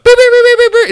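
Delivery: high mock metal detector beeps; beeping detector. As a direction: squeak out the alarm beeps. Imitating airport metal detector going off.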